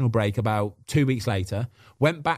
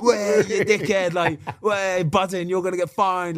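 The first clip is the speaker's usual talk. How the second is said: high, gleeful mock jeers from the lads; hammed-up banter, pitched high and gloating; jeering teammates; 8 semitones higher, slower than usual. Teammates jeering at a late keeper, high and gleeful. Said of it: "All the lads are like".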